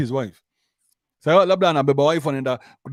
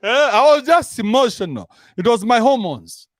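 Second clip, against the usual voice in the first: higher, louder, lightly mocking plea; a light hint of someone playing innocent; husband half-heartedly faking the emotional excuse, raised; 8 semitones higher, 4 dB louder than usual. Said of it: "women use that excuse".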